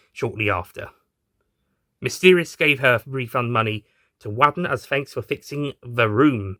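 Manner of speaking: ordinary delivery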